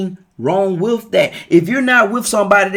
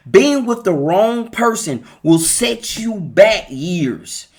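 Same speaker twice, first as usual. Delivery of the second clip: slow emphasis; much slower than usual. Real, not acted. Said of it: "but I want you to do this".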